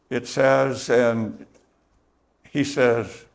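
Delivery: normal talking voice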